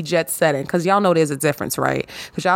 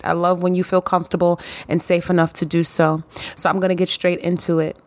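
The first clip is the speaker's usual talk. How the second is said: ordinary talk, nothing marked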